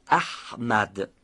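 احمد with the ح squeezed from mid-throat; constricted throat consonant. Shown as a demonstration. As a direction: tighten the middle of the throat on ح in احمد. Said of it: "از وسط حلق تلفظ کنید، گرفتگی خاصی هم". (احمد با حِ گرفته از وسط حلق)

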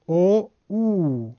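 first او as 'o', second as long 'oo'; damma versus vav. Shown as a demonstration. Teacher contrasting two vowels, very slow 'o', then 'oo'. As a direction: move from an 'o' to a drawn-out 'oo' on او او.

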